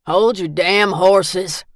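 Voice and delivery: loud, slow gruff yell; gruff shout. Acted. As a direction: bellow through the door. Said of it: "Grady's gravelly voice cut through".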